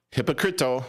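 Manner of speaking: mock Spanish.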